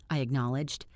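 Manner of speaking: ordinary delivery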